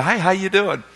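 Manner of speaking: soft, put-on breezy hello; casual greeting. Pastor catching the smoking wife, softly casual. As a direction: play it innocent and offhand. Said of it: "I go".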